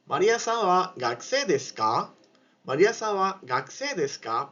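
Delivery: careful model question, "desu ka" going upward; rising question. Shown as a demonstration. Japanese teacher models a question for students, rising on "ka". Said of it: "hacemos un tono un poquito más alto".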